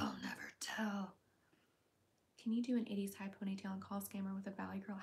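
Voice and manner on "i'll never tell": much lower spooky whisper; a low eerie whisper to the chat; deep mysterious whisper. Pretend. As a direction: drop the pitch way down and hush it. Coy answer repeated, deeper.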